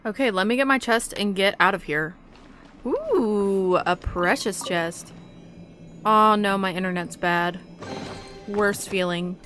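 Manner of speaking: ordinary talk, nothing marked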